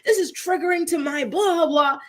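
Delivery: mock-indignant blah-blah imitation; mocking complainer. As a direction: mimic a whiny online rant, dismissively. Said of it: "She's like".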